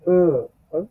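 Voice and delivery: ও lightly from inside, not overdone; teacher modelling ö; light German ö. Shown as a demonstration. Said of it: "হালকা একটু ভিতর থেকে, বেশি না".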